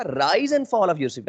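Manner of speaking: slow teaching demo of rise and fall in the voice; rise high, fall low. Shown as a demonstration. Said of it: "जब मैंने rise की बात की तो थोड़ा ऊंचा बोला और फिर फॉल की बात की तो थोड़ा नीचे बोला".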